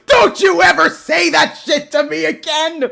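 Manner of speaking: high, very loud scolding; indignant shouting. Real, not acted. Streamer scolding after a comparison to Vincent, indignant shout. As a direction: shout them down in outrage.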